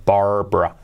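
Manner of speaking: "Barbara" as BAR-bra with a clear English R; pronounced R. Shown as a demonstration. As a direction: sound the R in "Barbara", low and falling. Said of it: "Con la R".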